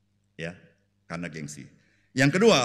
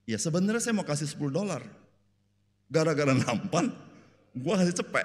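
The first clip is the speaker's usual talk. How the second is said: higher, mock-aggrieved grumble; retold complaint; 7 semitones higher than usual. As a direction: complain to the wife about overpaying. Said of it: "Dia ngeluh terus". (mengeluh kesal, menirukan)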